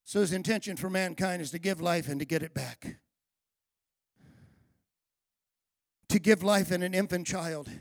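low, soft and tender; soft reflective preaching. Acted. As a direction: ease the room down gently.

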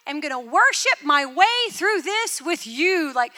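loud, high, passionate declaration; fervent vow to God. Retold or imitated. Praying through a hard year, loud fervent vow. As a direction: cry it out as a fierce promise to the Lord. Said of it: "I just thought".

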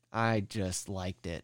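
slow, low, soft shrug of a quote; understated imitation. Voicing the other tunnel diggers, understated and slow. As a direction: imitate a simple admission, drawn out and quiet. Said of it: "Everyone else was just like".

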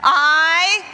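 loud, drawn-out "I" cueing a group; rallying the audience. Shown as a demonstration. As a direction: belt it out so everyone joins in. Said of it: "please try it one more time".

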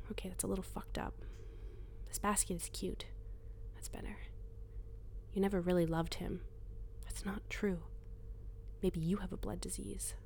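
hushed inner voice, close to the ear; a voice inside her head, whispering; whispered inner voice. Acted.

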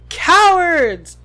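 huge loud bellow. A narrator's reading. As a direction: roar it across the grounds. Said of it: "bellowed Hagrid".

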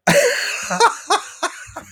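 high nervous giggle; an anxious burst of laughter. Real, not acted. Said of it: "That was a nervous laugh".

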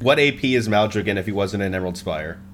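ordinary delivery

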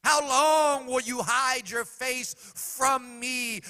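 loud, high, slow lament; King David crying out in anguish, loud. Retold or imitated. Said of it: "he cried out in the midst of his anguish".

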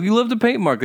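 high, loud mimicked whine; put-on indignant accusation; mock complaint. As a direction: imitate a driver making a big fuss. Mimicking a fussy complaining driver.

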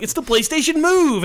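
high-pitched, overexcited product pitch; hammed-up sales enthusiasm; mock hype. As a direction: announce it like an overeager marketer. Mimicking company's excited pitch.

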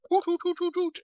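very high hooting calls. Retold or imitated. Wrestler running back and forth, hooting high. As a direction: hoot like an excited wrestler. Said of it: "he would just hoot real loud".

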